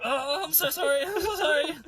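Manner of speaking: high, whimpering mock apology; panicked apology. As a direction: plead like a boy caught out. Harry apologising to angry dad.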